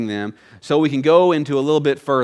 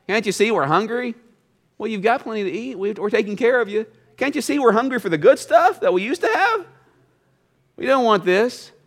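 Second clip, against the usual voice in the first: whiny grumbling Israelites; 7 semitones higher than usual. Acted. Preacher voicing grumbling Israelites.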